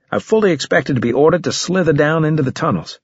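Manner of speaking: ordinary delivery, nothing marked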